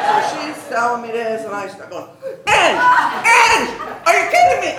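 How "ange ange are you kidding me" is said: high, loud shriek of disbelief; screaming. Retold or imitated. Teacher screaming at the principal on hearing she is losing her job, acted shriek. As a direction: re-enact an outraged scream at full pitch. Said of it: "I start screaming".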